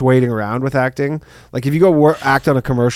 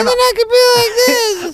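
squeaky falsetto, pitched way up; mock one of his voices in a high squeak; silly high voice. Friend mocking his range in a squeaky high voice. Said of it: "so many different voices".

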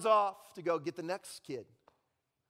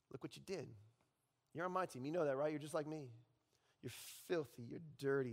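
quiet taunting Satan; 4 semitones lower, 7 dB quieter, faster than usual. Acted. Satan's voice resumes.

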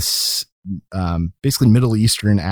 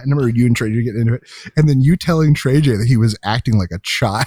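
usual speaking voice

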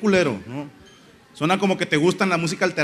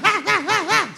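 high, sharp mock shouts of ah; mock stadium cry; imitated Japanese fan shout. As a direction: bark short chants like a disciplined fan section. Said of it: "y nada más gritan".

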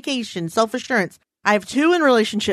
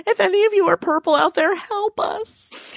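mock plea; 10 semitones higher than usual. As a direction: beg the audience in pretend despair. Mock plea to purple listeners.

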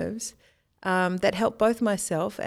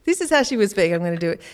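usual speaking voice

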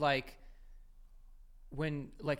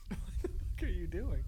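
laughing; 7 semitones higher, 7 dB quieter than usual. Real, not acted. Laughing at friend's trick.

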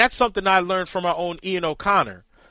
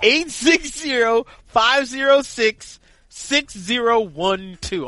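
higher, slow, pumped-up announcer read; hyped phone number read; 4 semitones higher, slower than usual. Shown as a demonstration. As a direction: sell the hotline number like a promo.

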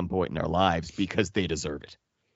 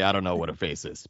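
ordinary talk

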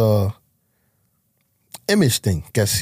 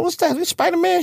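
a sharp, much higher question in disbelief; shocked disbelief. Retold or imitated. Father seeing his son's gang sign in a photo, stunned high disbelief. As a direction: recoil at the photo, pitch jumping up.

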